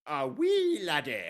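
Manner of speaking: loud, high, drawn-out Scottish caricature; mock Scottish accent. Teasing about Connery's Irish accent, loud mock Scottish. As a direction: belt out a hammed-up Scottish cry. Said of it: "Scottish people say weird things".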